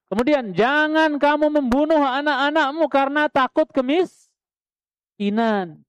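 solemn recitation. Acted. Recites verse meaning solemnly.

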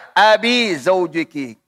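"Abi Zaujiki" with long i ending Abi; ya reading. Shown as a demonstration. Teacher showing the wrong ya reading, "Abi" with long i. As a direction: slowly say "Abi Zaujiki", stretching the i. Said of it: "atau pakai ya".

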